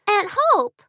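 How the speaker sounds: put-on high girl's voice, surprised; little girl. A narrator's reading. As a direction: play the young niece. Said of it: "Lucy's voice answered".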